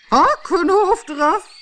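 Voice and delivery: very high, loud, slow scream; screaming queen. A narrator's reading. Queen screams for the gardeners' heads, very high, loud and slow. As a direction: roar the sentence at them. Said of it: "schreeuwde de koningin".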